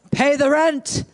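menacing melodrama villain demanding rent; mustache twirling villain. Acted. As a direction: sneer it like a stage villain. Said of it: "So here we go".

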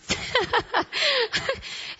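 high, self-conscious laugh; high giggle at yourself; real laugh. Real, not acted. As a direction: laugh off the awkward moment. Laughs at her own awkwardness.

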